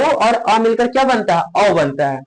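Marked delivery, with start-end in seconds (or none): none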